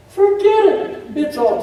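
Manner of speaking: mock high dismissal. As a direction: brush the worry off in a squeaky high voice. Preacher dismissing worry.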